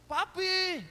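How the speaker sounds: high, booming summons through the house; loud tensed call. Shown as a demonstration. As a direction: holler it, pitched far up. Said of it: "Kalau bicara harus". (berteriak memanggil dengan nada tinggi)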